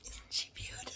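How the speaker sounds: whispering. Real, not acted. Whispered aside about puppy.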